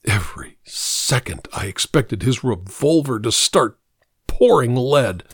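ordinary delivery, nothing marked

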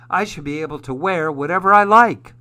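quick, loud, building insistence; indignant protest. A narrator's reading. Felix protests to his dad about skirts, indignant. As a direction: stand up for yourself, fast and emphatic. Boy protests unfair clothing rule.

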